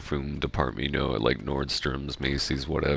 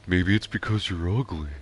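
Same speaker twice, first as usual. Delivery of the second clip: dopey cartoon sidekick attempt; not quite landing the cartoon voice; Patrick; 3 semitones higher than usual. Streamer trying out Patrick's line from the episode, Patrick impression. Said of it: "That's not a good Patrick".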